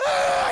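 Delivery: very high, loud scream; imitated piercing scream; kid screaming. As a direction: shriek like a terrified kid on Santa's lap. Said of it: "He starts screaming".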